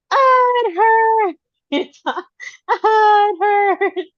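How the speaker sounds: high, loud, slow mock sob; mock crying child. Mom mimics daughter discovering the scratch again, slow high wail. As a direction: drag it out like a child fake-crying. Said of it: "she's like".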